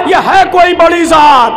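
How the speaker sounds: ये है कोई बड़ी ज़ात called out loud and full; loud refrain. Acted. Preacher proclaiming the monk's realisation, loud. Refrain then echoed softly.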